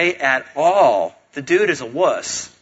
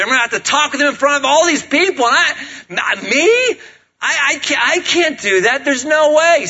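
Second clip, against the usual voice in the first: panicky whimper; 10 semitones higher, 4 dB louder than usual. Retold or imitated. Moses panics before facing Pharaoh.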